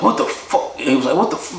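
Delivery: high, breathy-free hushed mock gasp of disbelief; shocked inmate.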